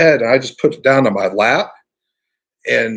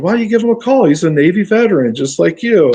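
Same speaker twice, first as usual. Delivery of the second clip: wife's gentle urging; 7 semitones higher, faster than usual. Retold or imitated. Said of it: "She was like".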